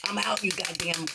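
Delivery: normal talking voice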